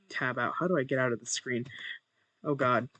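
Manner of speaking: normal talking voice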